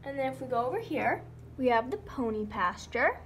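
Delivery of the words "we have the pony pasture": usual speaking voice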